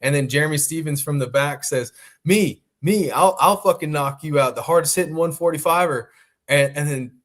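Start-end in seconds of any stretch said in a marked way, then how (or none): 2.2-6.1 louder, punchy trash talk; imitated call-out. Stephens challenges McGregor from the back of the presser, brash call-out.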